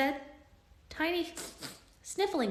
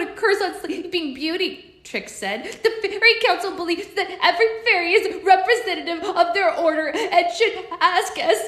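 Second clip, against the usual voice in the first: high, louder fairy voice, upset; fairy explaining her magic mishap; tiny fairy voice; 9 semitones higher, 8 dB louder than usual. Acted. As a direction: blurt it out anxiously.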